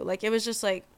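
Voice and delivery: ordinary talk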